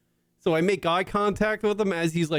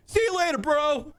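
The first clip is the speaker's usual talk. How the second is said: high mocking kid voice, pitched way up; 10 semitones higher than usual. Host mimics the bully kid leaving, high mocking voice. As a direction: toss it off like a smug little bully. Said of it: "he was like".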